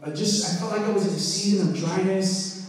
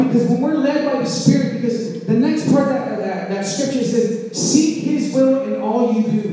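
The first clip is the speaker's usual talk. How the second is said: raised, ringing preacher voice; preaching at full force; 4 semitones higher, 4 dB louder than usual. Acted. Pastor urging the congregation, full preaching voice. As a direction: preach it out to the whole room.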